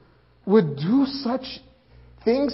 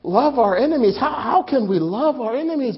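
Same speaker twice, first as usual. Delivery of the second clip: baffled disciples; 5 semitones higher, 5 dB louder than usual. Retold or imitated. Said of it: "we were baffled".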